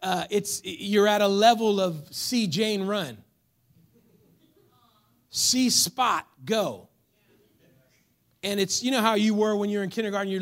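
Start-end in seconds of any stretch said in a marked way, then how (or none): 2.1-6.9 "See Spot go" read slowly, word by word; slow child reader. Shown as a demonstration. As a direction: read it like a kindergarten primer. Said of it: "I like to say it like this".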